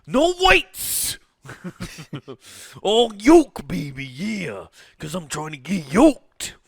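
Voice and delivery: high raspy wrestler boast; Macho Man voice. Acted. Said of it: "talking in Macho Man voice".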